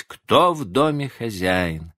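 ordinary delivery

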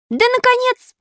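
very high exasperated puppet cry. A narrator's reading. Puppet at end of patience with fairy, crying out. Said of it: "закричал Пиноккио".

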